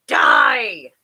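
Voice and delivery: low, loud angry snap; snapped. A narrator's reading. Wicked fairy correcting the deaf one, snapped. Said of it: "snapped Bibo".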